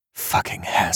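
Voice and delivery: tief und tonlos gehaucht; geflüsterter Fluch. Acted. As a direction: den Fluch für sich flüstern. Innerer Fluch des Erzählers. (tonlos und tiefer geflüstert)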